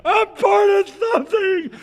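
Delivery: a very high, slow, ecstatic shout; joyful shout. Retold or imitated. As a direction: cry it out like a revelation. Overjoyed shout at the traffic light.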